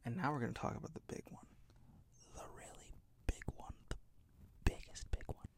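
fast conspiratorial whisper. Real, not acted. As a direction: lean in and whisper the secret. Teasing the big reveal.

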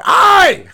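sudden high loud pained yelp; mock yelp. Fair worker hit by darts, yelping loud. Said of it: "throwing at my shoulder".

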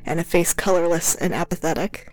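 slow, hushed gothic reading; story narration. A narrator's reading. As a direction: read it like a dark romance.

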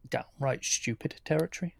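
normal talking voice

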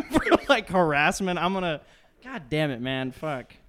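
loud, high comic exasperation; mock-angry rant. Threatening whoever reports the podcast for harassment, loud mock exasperation. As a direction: keep threatening through the joke.

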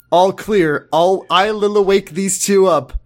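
usual speaking voice

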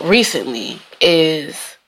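ordinary talk

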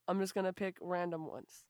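ordinary delivery

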